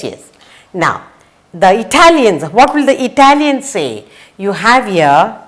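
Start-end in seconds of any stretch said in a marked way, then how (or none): none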